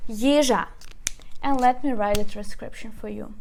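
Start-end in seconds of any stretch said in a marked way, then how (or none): 0.1-0.7 Yizha said as YI-zha, falling after the stressed start; stress on first syllable. Shown as a demonstration. Teacher repeating the word after the rule, Yizha stressed on the first syllable. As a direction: lean firmly on the "Yi" of Yizha. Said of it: "we put stress on the first syllable".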